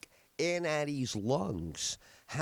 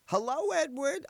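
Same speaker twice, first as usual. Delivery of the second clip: a higher, lightly voiced old aunt's greeting; elderly aunt greeting her nephew, higher voice; old aunt answering; 11 semitones higher than usual. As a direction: pick up the phone as a cheerful old aunt.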